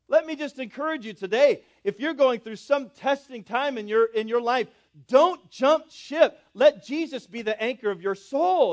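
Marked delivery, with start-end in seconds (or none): 5.1-6.4 emphatic plea. Real, not acted. Preacher exhorting.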